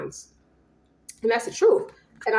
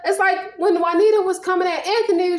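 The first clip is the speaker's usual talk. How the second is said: usual speaking voice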